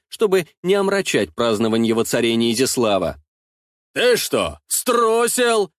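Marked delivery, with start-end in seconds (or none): 3.9-5.7 high, drawn-out mocking question; jeering. A narrator's reading. Ostap mocks his rival for cowardice, jeering. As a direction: taunt the rival, pitch leaping on the last word. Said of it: "рассмеялся Остап".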